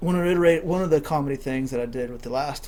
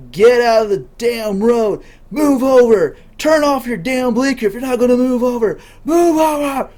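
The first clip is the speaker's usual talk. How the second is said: yelling road rage; 8 semitones higher, 9 dB louder than usual. Retold or imitated. Friend yelling at the driver in front, angry road-rage shout. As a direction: imitate a driver shouting at full voice. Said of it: "starts yelling".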